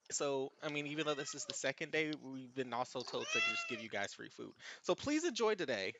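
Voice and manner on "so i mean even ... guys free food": ordinary delivery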